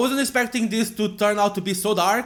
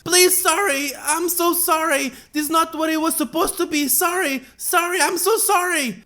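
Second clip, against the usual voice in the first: high, pleading and broken; crying girl's apology; 10 semitones higher than usual. Acted. Yelanite girl apologizing to the grieving man, crying. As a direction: sob out apologies. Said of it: "The girl cries".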